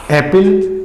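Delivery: ordinary delivery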